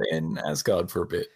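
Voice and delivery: pleading to Korg. Retold or imitated. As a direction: beg him gently to stop. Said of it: "In this, I'm like".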